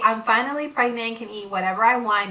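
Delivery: usual speaking voice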